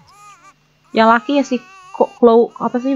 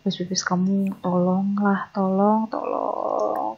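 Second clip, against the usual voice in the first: soft pleading; 7 dB quieter, much slower than usual. Real, not acted. Pleading softly with the baby.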